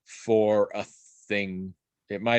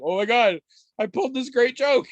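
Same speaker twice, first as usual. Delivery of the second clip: put-on high, louder proud little-kid voice; 12 semitones higher, 5 dB louder than usual. Toddler proud of his cereal joke, high crowing. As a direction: crow it like a kid pleased with his prank.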